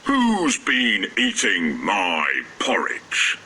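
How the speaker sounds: slow, heavy papa bear voice; Father Bear. A narrator's reading. Father Bear finding his porridge eaten, slow and heavy. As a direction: drag it out like a big bear. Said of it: "Father Bear looked in his porridge bowl and said".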